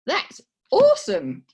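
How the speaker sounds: high, loud, slow Australian "That's awesome"; mock Aussie drawl, stretched out; a proud try at sounding local; Aussie accent. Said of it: "a little bit of an Aussie twang".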